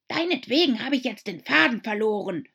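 indignant shout. A narrator's reading. Mouse to Alice, indignant shouting. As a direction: shout at her in a huff. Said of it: "schrie die Maus aufgebracht".

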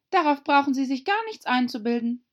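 put-on high, loud girlish voice; young Alice retorting. (hohe, laute Alice-Stimme)

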